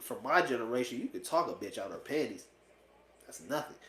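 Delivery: ordinary talk, nothing marked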